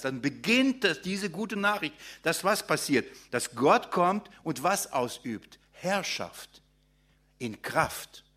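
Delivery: ordinary talk, nothing marked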